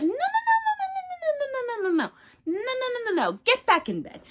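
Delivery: high, rapid string of panicked nos; frantic refusal. Retold or imitated. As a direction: babble no over and over in a panic. Mina frantically refusing the shoes.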